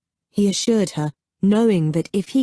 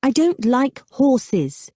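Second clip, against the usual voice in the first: slow, higher, repeating it firmly; insistent repeat; 8 semitones higher, slower than usual. A narrator's reading. Daughter refusing Andres's horses, slow and insistent. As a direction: say it again so it sticks. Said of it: "she reiterated".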